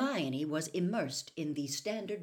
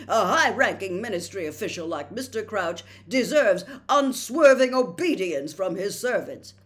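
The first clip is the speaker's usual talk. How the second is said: loud, higher self-important official; pompous lecture; 4 semitones higher, 12 dB louder than usual. A narrator's reading. Percy lecturing Hermione on obedience, pompous and loud. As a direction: speak as the rule-bound official.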